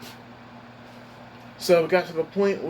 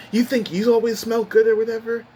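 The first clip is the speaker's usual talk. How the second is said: higher, louder mock accusation; freaking out, imitated; 6 semitones higher, 5 dB louder than usual. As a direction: imitate an angry coworker's outburst. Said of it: "he freaked out at me".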